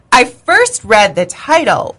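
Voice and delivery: ordinary delivery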